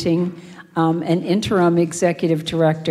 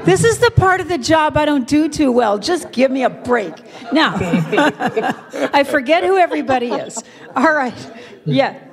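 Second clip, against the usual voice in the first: laughing; 7 semitones higher, faster than usual. Real, not acted. Flustered apology, laughing.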